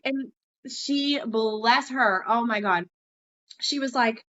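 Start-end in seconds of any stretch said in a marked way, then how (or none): none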